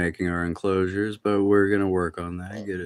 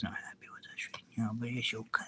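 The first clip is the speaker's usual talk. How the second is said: whisper; 16 dB quieter, faster than usual. Real, not acted. Tortoise trying to sleep.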